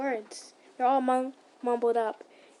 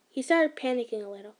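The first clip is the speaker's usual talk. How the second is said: plain reading voice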